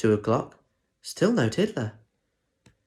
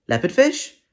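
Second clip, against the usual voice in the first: teacher calling register; 7 semitones higher, 6 dB louder than usual. Acted.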